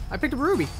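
ordinary delivery

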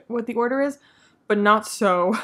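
ordinary talk, nothing marked

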